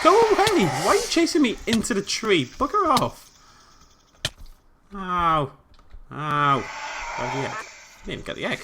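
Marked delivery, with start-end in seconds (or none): 0.0-3.3 high, loud panicked shouting; being chased. Real, not acted. Fleeing dinosaur chasing him. 4.9-6.6 loud high ow cries; pained yelps. Real, not acted. Being bitten.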